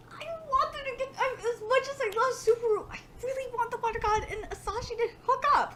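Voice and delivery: high, wailing fangirl swoon; gushing squeal. Real, not acted. Reader rooting for Water God and Asashi, gushing. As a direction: coo and whine over a beloved couple. Gushing over the couple in story.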